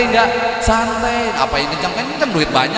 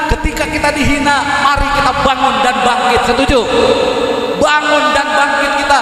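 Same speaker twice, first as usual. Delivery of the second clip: rousing sermon cry, pressing each phrase; rally the crowd to get up and fight back; preaching at full force; 7 semitones higher than usual. Acted. Preacher rallying the congregation, rousing full-force call.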